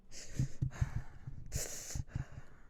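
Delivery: shuddery breaths, no voice; pretend freezing shiver. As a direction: act out shivering from cold with the breath alone. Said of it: "pretending like I'm freezing".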